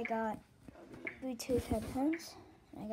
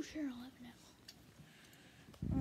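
slow whisper; 8 dB quieter, much slower than usual. Real, not acted. Whispered reply to offer.

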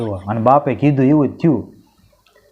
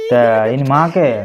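usual speaking voice

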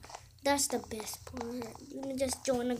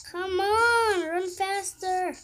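urgent shout; 6 semitones higher, 8 dB louder, slower than usual. Real, not acted. Urging himself while fleeing.

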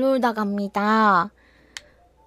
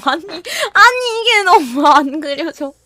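whiny fake crying; 5 semitones higher, 6 dB louder than usual. Real, not acted. Frustrated by drawing failing.

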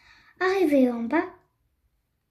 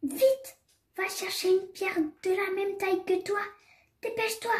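high, soft storybook stage whisper; high-pitched hushed hurry-up; hushed urging; 6 semitones higher, 6 dB quieter than usual. A narrator's reading. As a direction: urge in secret, high and small. Hen secretly urges the rooster, as before.

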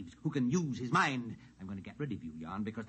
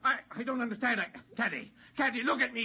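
bewildered plea; 11 semitones higher, faster than usual. Acted. George bewildered, pleading.

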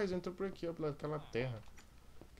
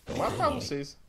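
high frantic shouting; panicked yell; 3 dB louder than usual. Real, not acted. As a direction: shout in disbelief. Zombie breaking in.

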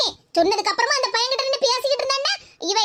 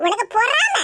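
quarrelsome woman. Acted. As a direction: bicker back with a wounded, sly edge. Second woman taunts.

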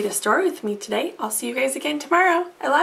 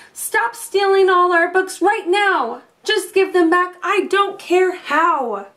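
higher, louder shouted demand; shouting; 5 semitones higher, 3 dB louder than usual. Acted. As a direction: yell it out the window at the thief. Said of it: "shouted to the thing outside".

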